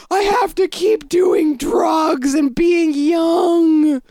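slow, high, strained mock shout; hammed-up holler, every word stretched; mocking aging rockers. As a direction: drag it out loudly like a desperate has-been. Mocking aging rock stars.

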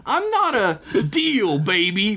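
high, slow cutting-in voice. Acted. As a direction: cut her off smugly. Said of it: "Mickey cut her off".

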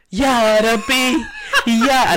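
slow, high, laughing exclamation; laughing mock dismay. Friend laughing at the legal worry, hammed-up dismay. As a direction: drawl it out through laughter.

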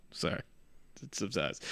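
whispered, barely audible sorry; hushed apology. Real, not acted. Speaker apologizes for calling people bitches, hushed and sheepish.

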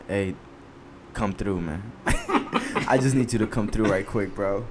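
low, soft, serious phone voice; quietly confidential request to a buddy. Retold or imitated. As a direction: drop it low and keep it on the down-low. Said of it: "I like your serious voice".

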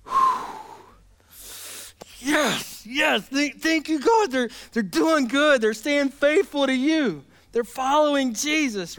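exhale, then thank God slowly; relieved thanks. Retold or imitated. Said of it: "picture the Apostle Paul just like".

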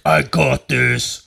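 low, slow put-on macho voice; drawn-out, deep mock bravado; mock tough guy. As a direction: imitate a guy acting hard. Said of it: "just trying to be hard".

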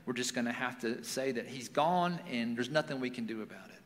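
subdued, softly resigned father; understated stoic dad, giving up. Retold or imitated. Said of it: "her dad was kinda being stoic".

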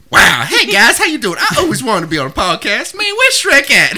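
high, loud, excitable talking donkey; Donkey from Shrek. Acted. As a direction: burst in as an overeager cartoon sidekick. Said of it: "Donkey from Shrek. How you doing, Donkey?".

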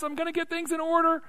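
high-pitched voice of someone out to impress; mock striving. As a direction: pitch it right up, parodying eager effort. Mimics someone trying to impress.

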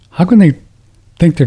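jumps high on can, then drops; disbelieving high question; incredulous. Real, not acted. Host fumes at mandates, high incredulous question.

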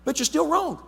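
put-on a dad setting his kid straight; parent to child. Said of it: "I need to say".

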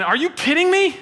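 high, loud disbelief; incredulous mourners. Retold or imitated. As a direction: scoff at the idea as an outraged mourner. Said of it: "they're going".

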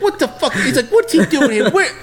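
high incredulous voice through laughter; laughing clerk impression. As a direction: squeal it out in disbelief, laughing.

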